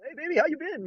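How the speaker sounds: high-pitched, breezy mock greeting; acting nonchalant. Coach showing how to react when they come back, breezy. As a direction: greet an ex as if nothing happened. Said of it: "Like nothing".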